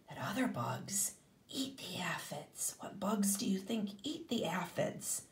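put-on hushed whisper to the kids; secretive whisper. Teacher builds suspense for children.

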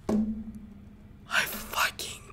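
very slow, soft whisper; stunned whisper. Real, not acted. Player whispers slowly after losing the egg. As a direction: trails off in disbelief. Reacting to the character's death.

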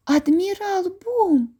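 high, slow astonished cry; greatly surprised. A narrator's reading. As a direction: blurt out the name in shock. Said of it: "страшенно здивувавшись". (Джейн дуже здивовано вигукує)

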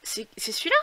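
ordinary delivery

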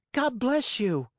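very high-pitched puppet blessing; high childlike character voice; little puppet's voice. A narrator's reading. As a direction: pipe it up like a small puppet. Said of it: "said Pinocchio".

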